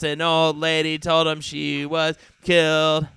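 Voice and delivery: usual speaking voice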